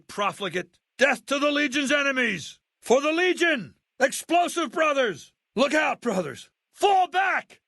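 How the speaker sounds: shouting legionary, harsh and high; war cries of a Legion soldier in battle; Legion soldier barks. Acted. Legion soldier threatening and yelling at his enemies.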